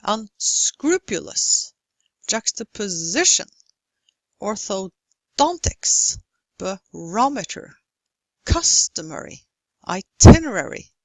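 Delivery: unSCRUpulous, juxtapoSItion, orthoDONtics, baROmeter, CUStomary, iTINerary; stressed syllable marked. Shown as a demonstration. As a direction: lean hard on SCRU, SI, DON, RO, CUS, TIN. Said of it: "Which syllable receives stress in these?".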